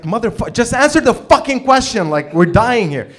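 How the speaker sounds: high, loud exasperated shout; angry yell. Retold or imitated. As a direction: scream at him to just answer. Peter yelling for an answer.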